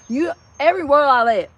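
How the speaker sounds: lightly imitated unintelligible Southern talk; garbled Southern accent. Mocking people down south nobody understands, garbled Southern accent. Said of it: "you don't even know they, they're saying".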